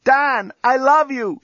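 high, drawn-out loving shout; high-pitched call of love. A narrator's reading. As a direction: shout it from another cell. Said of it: "shouted Lucy from her cell".

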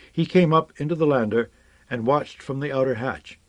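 plain reading voice

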